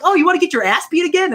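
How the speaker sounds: put-on high cheeky taunt from a kid; mocking kid voice. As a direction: needle him like a bratty child. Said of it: "They're like".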